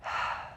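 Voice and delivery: long exhaled sigh through the mouth; demonstrated breathing-exercise sigh, all air; audible sigh. Shown as a demonstration. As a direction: let the breath out in a voiceless sigh. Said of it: "with an audible sigh".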